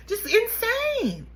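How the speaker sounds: high, slow, drawn-out insane; disbelief. Real, not acted. Reacting to the mother's lies, slow disbelieving drawl. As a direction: stretch it out in disbelief.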